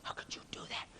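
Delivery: hushed, voiceless mock outrage; scandalized whisper, imitating upset students; mock outraged whisper. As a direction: whisper the angry complaint. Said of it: "they got so mad".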